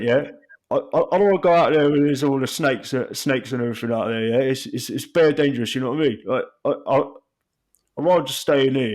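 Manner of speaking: a hint of Rio's London patter; bad Rio impression. Mate in a tent, scared of snakes, bad Rio impression. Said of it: "A really bad impression".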